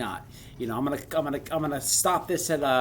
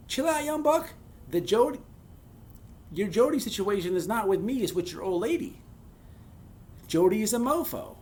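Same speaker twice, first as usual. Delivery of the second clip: put-on knowing older inmate; 6 semitones higher than usual.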